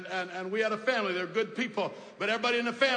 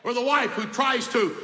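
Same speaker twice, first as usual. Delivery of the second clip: ordinary talk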